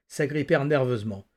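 ordinary delivery

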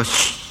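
sharp drawn-out shush; imitated woman's shush. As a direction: hush someone in the audience. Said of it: "una mujer me dijo".

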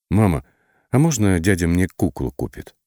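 plain reading voice

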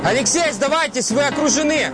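shouting. Acted. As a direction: bark the surrender order. Police calling out to armed man.

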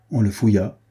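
usual speaking voice

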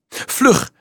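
high, urgent call. A narrator's reading. Frank running up to the children, calling out. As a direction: hurry them with a cry. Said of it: "riep hij".

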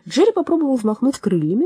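ordinary delivery, nothing marked

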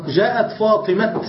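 "فاطمة" ending on a sounded t, "Fatimat"; pausal t ending. Shown as a demonstration. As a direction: slowly stop "فاطمة" on a hard t. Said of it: "ويقفون عليها بالتاء".

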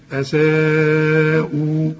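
"أساءوا" with the alif stretched four beats before the hamza; slow, drawn-out "أساءوا" with a held alif; long madd. Shown as a demonstration. Quran teacher drawing out the required madd in "أساءوا". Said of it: "في نفس الكلمة اللي فيها الهمزة".

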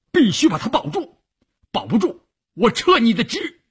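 high, forceful threat; furious ultimatum. A narrator's reading. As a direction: order the doctor with a threat, pitched high. Officer threatens doctor. (厉声威胁)

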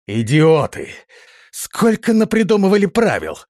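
high-pitched indignant rant; exasperated outburst. Acted. Prince complains about the guards' procedures, indignant and exasperated. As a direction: fume at senseless rules, voice climbing high.

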